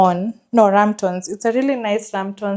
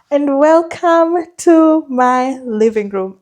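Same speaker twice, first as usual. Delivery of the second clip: gushing real estate agent; 4 semitones higher, 4 dB louder, slower than usual. Acted. Presenting the room on a house tour.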